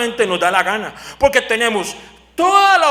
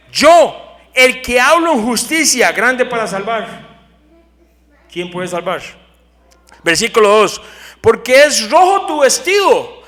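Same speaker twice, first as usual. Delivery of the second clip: louder, slow proclamation of Isaiah; proclaiming scripture; 2 semitones higher, 5 dB louder than usual. Acted. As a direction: proclaim the prophet's words.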